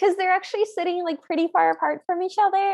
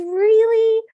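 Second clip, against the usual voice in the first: high, loud questioning burst; can hardly believe it, pitch lifted; surprised delight; 6 semitones higher, 8 dB louder than usual. Retold or imitated. Cinderella hearing the ball is for her, surprised delight.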